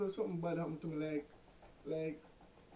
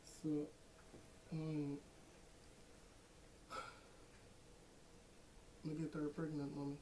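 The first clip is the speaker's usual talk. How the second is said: hushed confession; 2 semitones lower, 10 dB quieter than usual. Acted. Fake confession to mother on phone.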